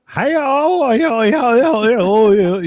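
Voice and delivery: high, loud, twangy Alabama "y'all" parody; mock the Alabama accent with a high, loud "y'all". Mocking the Alabama accent from a cruise, high and loud. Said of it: "I don't love the Alabama accent".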